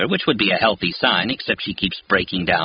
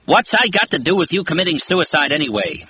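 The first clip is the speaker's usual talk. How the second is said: yelling; 9 semitones higher than usual. A narrator's reading. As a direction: shout it at her in frustration. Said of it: "I'm yelling".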